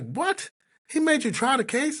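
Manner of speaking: high-pitched incredulous question; acted disbelief, voice way up; retold astonishment. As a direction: imitate a stunned colleague. Partner's retold shocked reaction.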